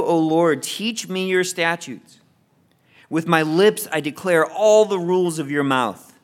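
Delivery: ordinary delivery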